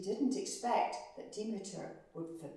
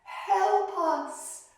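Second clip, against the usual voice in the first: loud, high pleading cry to the gods; desperate prayer; 9 semitones higher, 9 dB louder, slower than usual. Acted. Suffering people crying loudly to the gods for help. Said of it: "they prayed to the gods".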